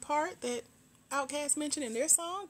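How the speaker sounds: high-pitched put-on wonder, hammed up; mock wondering. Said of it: "you be like".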